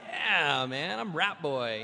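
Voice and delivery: put-on cocky Rat Boy. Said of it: "And I'm like".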